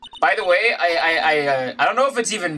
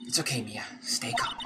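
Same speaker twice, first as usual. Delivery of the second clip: whispering; 6 semitones lower, 11 dB quieter than usual. Acted. Inner reassuring thought.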